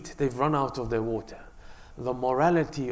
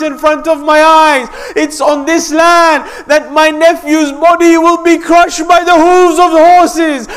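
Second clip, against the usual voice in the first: preaching building to a wail; 13 semitones higher, 19 dB louder than usual. Acted. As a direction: cry the prophecy out ever louder, near shouting. Imam's lament at Karbala.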